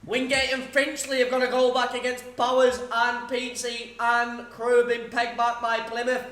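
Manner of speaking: results commentator. Acted. Streamer calling scores to chat like a live commentator.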